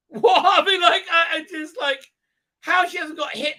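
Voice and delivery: high, laughing disbelief; real giggling astonishment. Real, not acted. Laughing at the scene.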